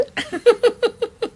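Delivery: shrill mocking cackle; high laugh. Real, not acted. Taunting the reporter with a high laugh.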